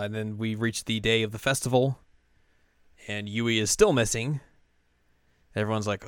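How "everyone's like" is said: ordinary talk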